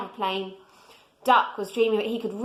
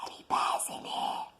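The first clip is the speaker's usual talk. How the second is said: soft unvoiced whisper into the dark; 11 dB quieter than usual. A narrator's reading. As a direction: breathe it out as a whisper. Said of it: "he whispered".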